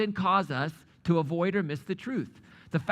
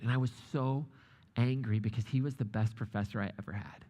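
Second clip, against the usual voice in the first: ordinary talk, nothing marked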